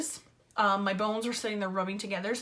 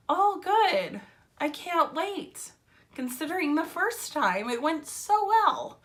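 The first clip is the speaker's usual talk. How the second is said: high, mock-delighted sarcasm; sarcastic enthusiasm; 7 semitones higher than usual. Patient referred back to orthopedics, sarcastic delight. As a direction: pitch it up and stretch it, falsely thrilled.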